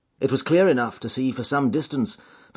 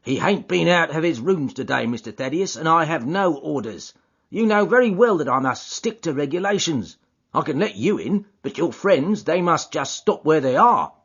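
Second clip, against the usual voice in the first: lightly raised stubborn doorkeeper; porter McMurdo; 7 semitones higher, 4 dB louder than usual. Acted. Porter refusing Thaddeus's friends, lightly stubborn. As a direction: stand firm at the door.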